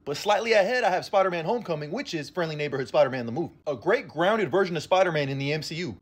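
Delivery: usual speaking voice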